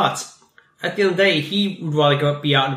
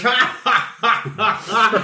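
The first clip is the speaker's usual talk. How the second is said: villain laugh; 6 semitones higher, much slower than usual. Retold or imitated. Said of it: "throws back his head and goes".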